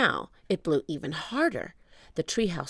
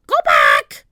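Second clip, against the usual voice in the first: much lower, loud shouted squawk; loud parrot squawk; 14 semitones lower, 14 dB louder than usual. A narrator's reading. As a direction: screech a warning like a parrot. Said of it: "she squawked".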